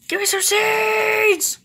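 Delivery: hammed-up high-pitched demand; mock tantrum. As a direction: whine it like a spoiled kid screaming. Demanding seeds from game.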